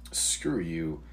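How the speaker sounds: normal talking voice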